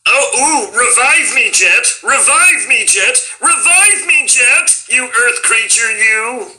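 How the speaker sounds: high, proper little cartoon alien; Marvin the Martian. Voice actor's last but not least impression, high Martian voice. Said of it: "the original voice of Marvin was, was not that voice".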